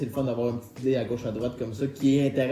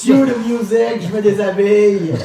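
excited shout; 5 semitones higher, 10 dB louder than usual. Pretend. Host playfully announces his segment.

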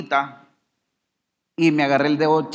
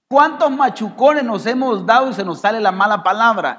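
high, loud pulpit question; forceful preaching; 7 semitones higher, 6 dB louder than usual. Acted. Preacher confronting congregation about swearing when hurt, forceful preaching. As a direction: challenge the congregation, voice raised.

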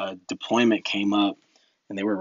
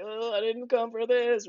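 much higher, panicky mock voice; mock scared; 12 semitones higher, 3 dB quieter than usual. Soldier on a diving plane, mock panic. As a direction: hold on for dear life and whine it.

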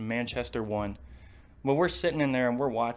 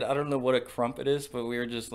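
ordinary talk

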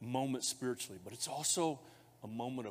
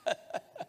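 high laugh. Real, not acted.

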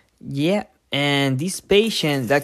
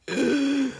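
gasp; 11 semitones higher than usual. Shown as a demonstration. Acts burned patient.

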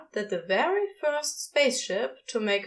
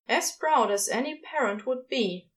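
plain reading voice

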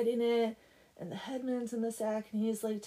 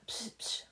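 short, soft spitting noises; imitated spitting; 5 dB quieter than usual. Villagers spitting on the painted devil, imitated spitting. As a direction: mime two quick spits. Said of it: "everyone would spit on it".

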